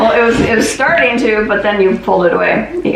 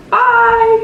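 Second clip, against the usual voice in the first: cheerful high goodbye; 13 semitones higher than usual. Real, not acted. Cheerful sign-off.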